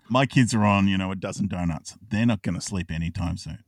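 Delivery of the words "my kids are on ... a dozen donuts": ordinary talk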